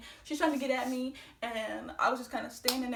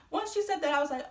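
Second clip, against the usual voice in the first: normal talking voice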